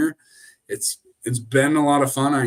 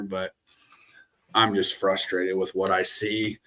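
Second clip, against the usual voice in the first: usual speaking voice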